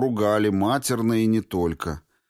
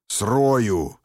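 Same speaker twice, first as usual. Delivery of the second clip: горячо; 3 dB louder than usual. A narrator's reading. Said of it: "вырвалось у Арестова".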